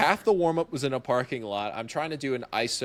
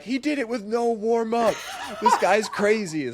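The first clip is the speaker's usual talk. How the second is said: higher, laughing hype call; imitating hype; 10 semitones higher, 4 dB louder than usual. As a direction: hype it up like a stream host. Hosts hyping the dunk.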